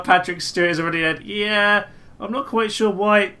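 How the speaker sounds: normal talking voice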